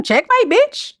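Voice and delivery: high, gloating sing-song taunt; taunting checkmate. Retold or imitated. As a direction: crow it like a winner. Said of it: "That's how you do them".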